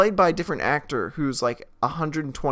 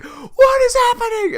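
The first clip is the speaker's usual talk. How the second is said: mock screaming; 10 dB louder than usual. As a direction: shriek it in overblown panic. Screaming at each other.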